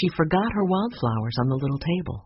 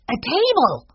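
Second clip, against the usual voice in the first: put-on high exclamation of a young boy; storybook boy, thrilled by a find; Benny's excited cry; 9 semitones higher, 4 dB louder than usual. A narrator's reading. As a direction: cry out the discovery in a raised child voice. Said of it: "cried Benny".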